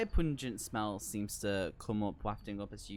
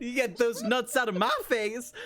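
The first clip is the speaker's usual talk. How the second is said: annoyed shout; 10 semitones higher, 6 dB louder than usual. Acted. Character rejects offered nuts.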